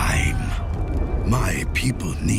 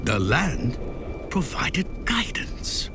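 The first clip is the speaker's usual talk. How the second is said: hushed, halting reflection with long pauses; inner thought; 4 dB quieter than usual. A narrator's reading. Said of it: "he thought".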